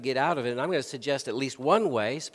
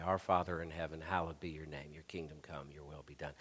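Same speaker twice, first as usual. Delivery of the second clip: much softer, lower, fading to a murmur; quiet recited prayer; 10 semitones lower, 14 dB quieter, faster than usual. Shown as a demonstration. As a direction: murmur the Lord's Prayer to yourself. Reciting the Lord's Prayer as example.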